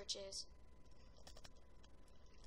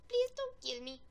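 pleading enemy; 10 semitones higher, 3 dB louder than usual. Retold or imitated. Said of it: "He's like".

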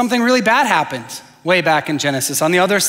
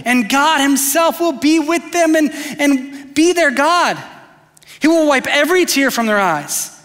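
high, jubilant proclamation; giddy voice of God; 6 semitones higher than usual. Acted. God announcing from the throne that pain is over, giddy. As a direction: announce it joyfully, pitched up, with giddy anticipation. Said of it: "I picture that voice being a giddy voice".

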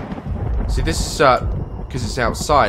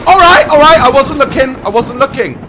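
flustered; 13 semitones higher, 12 dB louder than usual. Real, not acted. Recovering from scare.

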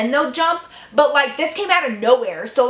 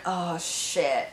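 dread mutter; 6 semitones lower, 9 dB quieter than usual. Retold or imitated. Caught by counselor.

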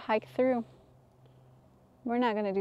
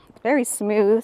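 ordinary delivery

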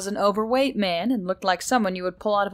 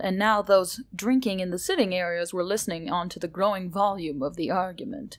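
plain reading voice

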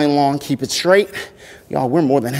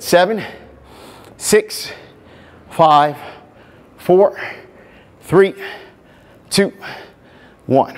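energetic countdown; 4 semitones higher, much slower than usual. Real, not acted. Counting down final reps.

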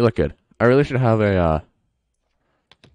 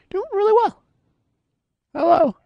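high sing-song. Pretend.

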